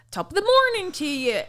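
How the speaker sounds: high, hammed-up Irish accent; exaggerated Irish greeting, pitched high; horrible Irish accent. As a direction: put on a bad stage-Irish lilt. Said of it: "so can I show you my accent".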